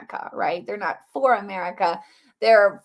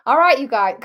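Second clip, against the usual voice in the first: higher, louder mock Cockney; Cockney accent; 5 semitones higher, 8 dB louder than usual. Shown as a demonstration. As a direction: call out in playful Cockney. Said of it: "Cockney, yes".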